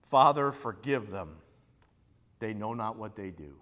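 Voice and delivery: quiet, tender prayer for the crowd; tender plea. Retold or imitated. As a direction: plead gently for their forgiveness. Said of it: "he looks at them and he says".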